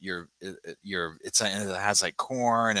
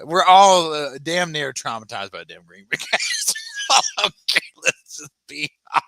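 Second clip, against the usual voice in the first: laughing; 7 semitones higher, 7 dB louder than usual. Real, not acted. Laughing rant.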